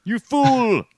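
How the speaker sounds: high, loud theatrical scolding; mock outrage. Host playfully scolds a forgetful co-host, high mock outrage. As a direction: berate them in hammed-up indignation.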